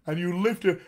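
hammed-up, projected operatic speech; opera singer voice. Shown as a demonstration. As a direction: boom it out like a bad opera singer. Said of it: "your best opera singer voice".

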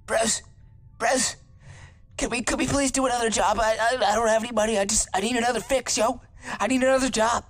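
hammed-up desperate moocher voice; whiny begging. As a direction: beg like a broke friend crawling back. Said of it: "he'll come crawling back like".

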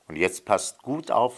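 usual speaking voice

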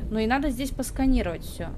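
ordinary talk, nothing marked

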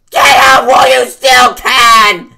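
shout. Acted. Sudden scare yell.

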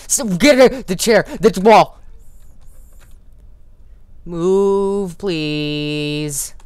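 shrill high-pitched terrified screams; panicked shrieking. Real, not acted. Player's character won't move near monster, terrified shrieking. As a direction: shriek in real panic as you're trapped.

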